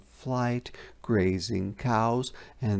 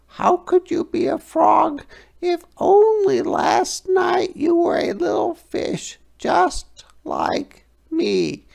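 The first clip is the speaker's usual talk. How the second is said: very high, louder little fish voice, arguing; indignant minnow; 6 dB louder than usual. A narrator's reading. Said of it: "said the minnow".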